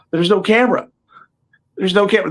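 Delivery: high-pitched retold disbelief; mock disbelief. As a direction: act out realizing it was all for nothing. Retold shock at missing camera mid-take.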